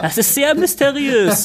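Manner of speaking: high, slow mock-baffled voice; mock mystery voice. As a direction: play it faux mysterious. Mock puzzled continuation.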